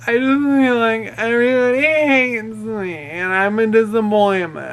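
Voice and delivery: high, wailing imitation of a sobbing drunk; mock bawling. Drunk passenger confides in her driver, mock bawling. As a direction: whine it out in one long exaggerated wail. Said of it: "starts bawling".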